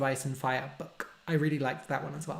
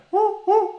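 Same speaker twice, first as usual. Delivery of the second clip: very high, loud hoots; owl hoot; 9 dB louder than usual. Shown as a demonstration. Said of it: "And then owls go".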